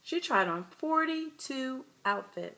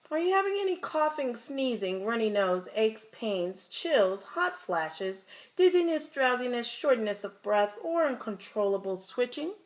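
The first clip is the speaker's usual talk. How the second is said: rapid list. Acted. Doctor rattling off symptoms.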